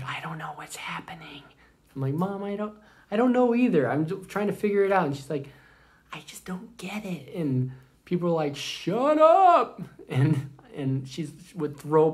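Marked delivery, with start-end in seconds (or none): 0.0-1.4 hushed, voiceless whisper of a confused mom. Retold or imitated. Mom talking during the movie. 2.1-5.1 put-on higher, louder, fast teen pleading; exasperated teen son. Son answers mom in theater. 6.1-7.3 hushed, voiceless whisper of a confused mom. Retold or imitated. 8.5-9.8 much higher, loud mock snap; angry moviegoers. Moviegoers telling them off.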